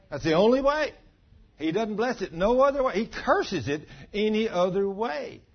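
loud, high declaration; preaching at full force. Real, not acted. Preacher to congregation on marriage, loud and high. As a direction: proclaim it as final law.

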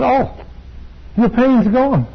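high, bright burst of sudden joy; excited realization. Retold or imitated. Preacher retelling a healing, exclaiming with sudden joy. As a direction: exclaim it like you just felt the healing.

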